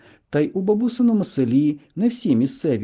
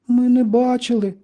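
put-on high-pitched, loud girlish voice; high, protesting schoolgirl voice; girls answering together; 12 semitones higher, 5 dB louder than usual. A narrator's reading. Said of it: "майже хором відповіли Мавка й Оксана".

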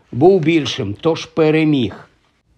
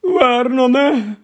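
high, slow frightened call; gasping in alarm; 10 semitones higher, slower than usual. Acted. Aunt gasping her husband's name after reading the letter, frightened. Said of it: "вона хапнула ротом повітря".

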